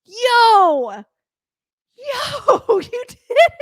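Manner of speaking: can't hold in the joy; ecstatic. Real, not acted. Host cheering viewers after the chart jump, ecstatic. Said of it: "I'm freaking out right now".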